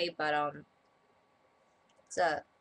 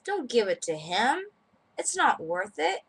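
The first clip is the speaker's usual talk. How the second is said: higher, slow dismissive client voice; slow scoffing aside, lifted high; quoted client; 6 semitones higher, 5 dB louder, slower than usual. Retold or imitated. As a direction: wave it off like a snooty client. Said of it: "And then he says".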